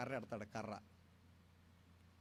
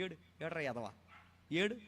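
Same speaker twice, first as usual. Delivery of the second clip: hushed, much softer taunt "ఏడ్రా ఎదవా"; mocking tease; 7 dB quieter than usual. Adult teasing a crying child, soft mocking coo.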